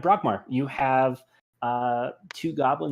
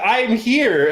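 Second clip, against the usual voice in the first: higher, louder cheerful arrival; loud announcement; 9 semitones higher, 6 dB louder than usual. Acted. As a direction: announce yourself loudly. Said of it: "You should have been like".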